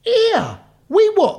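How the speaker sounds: high, disbelieving "we what"; surprised. Real, not acted. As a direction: react in shock, pitch jumping up. Shocked by convertible fact.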